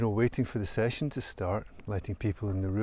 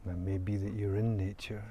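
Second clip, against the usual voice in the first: calm, soft meditation voice with long pauses; guided meditation; 9 dB quieter than usual. Real, not acted. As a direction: guide listeners gently into stillness, leaving long silences. Leads a guided nature meditation.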